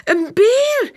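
very high, loud, delighted echo; excited child's cry. A narrator's reading. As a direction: chime in loudly as an excited little girl. Second little girl echoes the cry.

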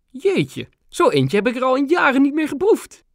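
high, surprised royal treat; queen pleased by a mint from Grandma; delighted queen. A narrator's reading. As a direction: savour it like a rare sweet. Queen tasting an old sweet.

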